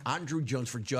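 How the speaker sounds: ordinary delivery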